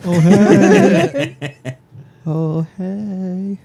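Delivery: high, laughing mock horse call; hammed-up horse. Horse greeting the sleeper, hammed-up.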